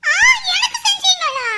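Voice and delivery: put-on high, loud child's squeal of surprise; surprised child. Little child shouting at mother in disbelief, high and loud. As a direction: squeal it like a startled little kid.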